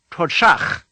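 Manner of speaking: Todshach ending like the ch in Bach; rasped final ch. Shown as a demonstration. Teacher points to the very end, Todshach with rasped ch. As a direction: force air through the end of Todshach. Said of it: "Listen to the very end".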